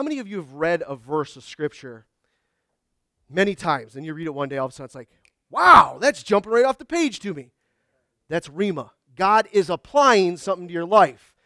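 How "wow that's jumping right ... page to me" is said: excited wow. Retold or imitated. Excited reader discovering verse.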